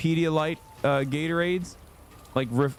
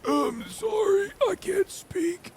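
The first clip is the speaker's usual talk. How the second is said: strained, much higher, slow pained squeak; cramped and breathless; slower than usual. Retold or imitated. Apologizing to the fan mid-cramp, strained high pained voice. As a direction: squeeze the words out through an ab cramp. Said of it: "I couldn't talk".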